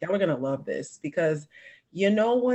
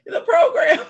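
high loud wailing "the program"; mock funeral cry; 14 semitones higher, 8 dB louder than usual. Mimicking a funeral crowd.

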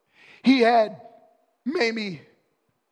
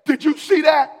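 excited; 11 semitones higher, 15 dB louder than usual. Retold or imitated. Students calling pastor.

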